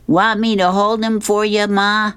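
put-on high-pitched kid offering Mom help; Curtis. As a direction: play an eager son, voice pushed up high. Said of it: "Curtis says".

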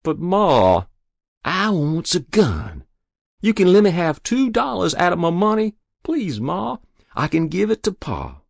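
put-on very high boyish pleading; begging. A narrator's reading. Boy begging Ma for two dollars, desperate pleading.

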